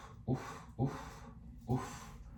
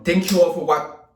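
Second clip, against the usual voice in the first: attempted Jersey accent; 7 semitones higher, 5 dB louder than usual. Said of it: "finish this with a Jersey accent".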